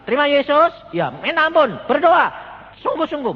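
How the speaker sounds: high, loud pleading; urgent mock shout. Imitating a preacher urging the sick man to repent, loud.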